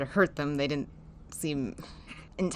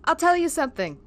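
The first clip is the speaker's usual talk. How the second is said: higher voice; 9 semitones higher than usual. Acted. N brushing off Willow in character.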